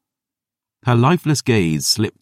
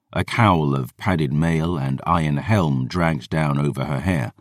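ordinary delivery, nothing marked

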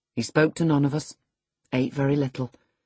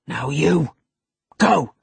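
terse command rising to a loud "go"; curt, mounting command; angry order; 5 dB louder, slower than usual. A narrator's reading. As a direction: order him out sharply. Heathcliff orders Earnshaw out.

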